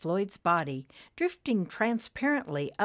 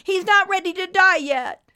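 high, loud protective yell; 13 semitones higher, 10 dB louder than usual. A narrator's reading. Friend drives the angels off the dying man, yelling. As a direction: yell them away, pitched way up. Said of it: "Nimbus yelled at the angels".

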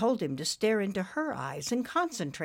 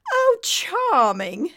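high, loud, drawn-out British grandeur; plummy British dame, projected; stately British accent; 12 semitones higher, 9 dB louder, much slower than usual. A narrator's reading. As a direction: gush slowly like a stately British lady. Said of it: "said in a most British stately accent".

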